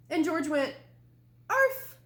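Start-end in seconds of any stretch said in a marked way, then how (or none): 1.5-2.0 high, loud yappy bark; puppy finally barking for his mother; puppy arf. Acted. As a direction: yip it out happily. Dog finally barks.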